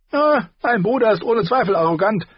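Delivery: high, barking and mocking; a sneering high dwarf who laughs at them. A narrator's reading. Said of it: "erwiderte Tyrion Lannister". (hoher, spöttischer Zwergentonfall)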